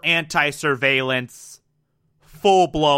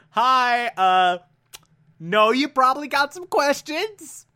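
breezy Finch brushing questions aside; Finch explaining; 8 semitones higher than usual. Retold or imitated. Finch calmly briefing Carter and Fusco, breezy and higher. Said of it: "And Finch is like".

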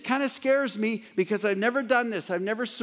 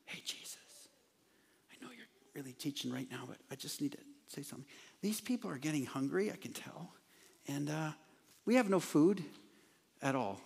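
hushed aside, barely voiced, much softer; quiet whisper; 3 semitones lower, 11 dB quieter than usual. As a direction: lean in and whisper it to Jesus so the crowd won't hear. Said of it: "I imagine kinda quietly".

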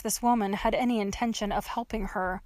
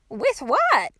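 put-on higher, louder old-lady retort; defensive old woman; 5 semitones higher, 5 dB louder than usual. A narrator's reading. Old woman challenges trapped girl, defensive and high. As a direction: throw the question back crossly.

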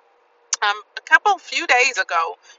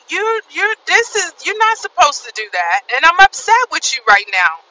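upset at God; 6 semitones higher than usual. Retold or imitated. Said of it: "I'm like".

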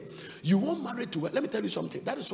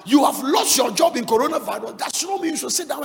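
loud, higher preaching over the music; fiery preaching; 4 semitones higher, 9 dB louder than usual. Acted. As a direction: whip the congregation up, shouting. Preaching at full force.

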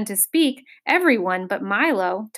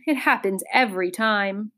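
ordinary delivery

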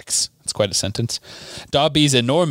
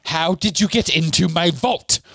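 mild mock scream; 5 semitones higher than usual. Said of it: "they heard Bellatrix scream".

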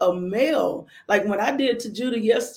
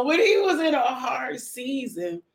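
higher, slow, playful stretch of the phrase; playfully drawn out; 5 semitones higher than usual. Pretend. Joking with the listeners about a hard season, playfully drawn out. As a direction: draw it out high for comic effect. Joking repeat after laughing aside.

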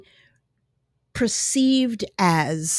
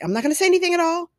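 mock afraid; 10 semitones higher, much faster than usual. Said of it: "Now they're just afraid".

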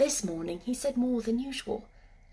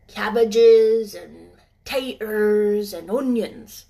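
put-on slow, louder Yorkshire old man; slow old gardener; 9 dB louder, slower than usual. Ben naming his vegetables, slow gruff Yorkshireman. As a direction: state it plainly, matter-of-fact.

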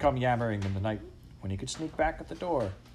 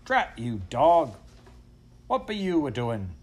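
higher, irritated rustic scolding; angry farmer; 4 semitones higher, 5 dB louder than usual. A narrator's reading. Farmer woken by barking dog, scolding angrily. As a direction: scold crossly from an upstairs window.

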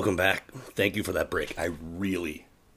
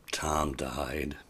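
usual speaking voice

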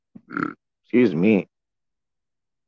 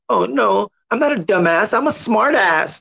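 higher, mocking smug voice; mock smart aleck voice; 7 semitones higher than usual. To listeners, mocking a self-styled smart ass in a high voice. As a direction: parody someone insisting they're smart. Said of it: "even though we're like".

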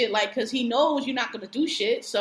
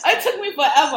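louder, higher, through laughter; laughing; 6 semitones higher, 8 dB louder than usual. Real, not acted. Proud of the impression she practiced, laughing. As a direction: say it while still laughing. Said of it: "I love your impersonation".

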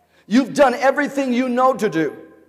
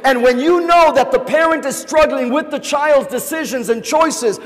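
ordinary talk

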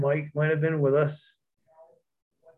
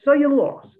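higher, louder scoff; scoffing retort; 11 semitones higher, 5 dB louder than usual. Retold or imitated. Steve scoffing at JBL's loss, higher and louder. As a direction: brush off his loss loudly.

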